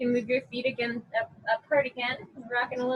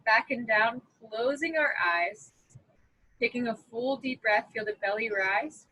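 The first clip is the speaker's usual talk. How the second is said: guided breathing meditation. Acted. Yoga teacher leading a breath count, calm guided meditation. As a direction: guide them calmly through each breath, unhurried.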